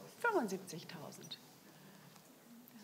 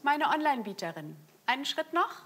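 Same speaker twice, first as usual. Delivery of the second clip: coaxing bidder; 6 semitones higher, 5 dB louder than usual. Real, not acted. Coaxing online bidder higher.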